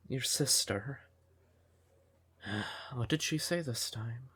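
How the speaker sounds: normal talking voice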